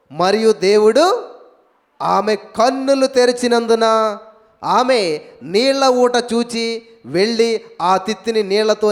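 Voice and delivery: slow, loud, measured Bible reading; solemn scripture reading. Acted. Preacher reads Genesis to the congregation, slow and loud. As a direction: read each verse out slowly to the congregation.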